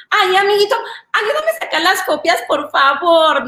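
mock girly pleading for favors; wheedling office girl. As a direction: coax a coworker in a baby voice. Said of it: "la que te habla".

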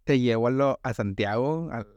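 normal talking voice

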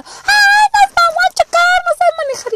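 high, loud panicked shriek; screaming friends. Retold or imitated. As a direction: shriek like terrified drunk girls in the car. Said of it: "por tanto grito".

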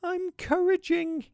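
put-on very high, slow little-child voice; tiny voice. Three-year-old James answers his dad through tears, tiny-voiced. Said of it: "said in a tiny voice".

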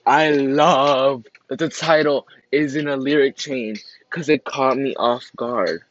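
loud, excited gushing; excited fan raving. Real, not acted. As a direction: burst out with enthusiasm. Gushes over the song.

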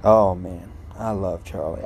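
ordinary delivery

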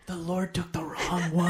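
high, loud hammed-up accusation; mother blaming the surviving son, hammed up; mock bitter mother. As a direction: cry it out at the wrong son. Voicing grieving mother.

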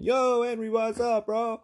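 high-pitched, over-enthused mock yell; screaming greeting. Greeting the store clerk on coming in, mock screaming. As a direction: holler it like an over-excited bro. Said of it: "He's not coming in screaming".

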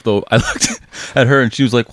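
usual speaking voice

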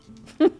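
quick, loud burst of laughter; sudden short laugh. Real, not acted. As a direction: let out one brief, loud chuckle. Laughs after "they died laughing.

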